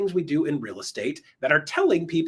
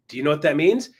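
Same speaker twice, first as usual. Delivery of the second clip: ordinary delivery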